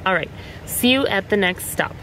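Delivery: ordinary talk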